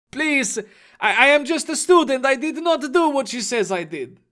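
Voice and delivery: high, fast, frightened pleading; a terrified student begging the agent. Acted. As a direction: beg for your life, rushing. Captured boy begs for release.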